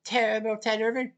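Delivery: usual speaking voice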